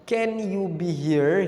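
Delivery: slow model sentence. Shown as a demonstration. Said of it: "tetap pakai be".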